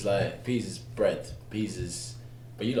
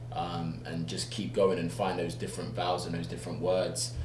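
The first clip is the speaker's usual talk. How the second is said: normal talking voice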